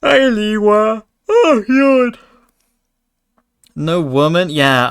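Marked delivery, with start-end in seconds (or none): 0.0-2.2 a high put-on voice for the hello; high silly voice greeting the streamer; squeaky greeting.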